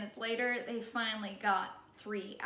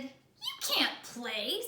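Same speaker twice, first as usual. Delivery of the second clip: put-on slow, dismissive kid's voice; mean kid saying no; Terrence refusing; 3 semitones higher, much slower than usual. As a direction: brush him off slowly.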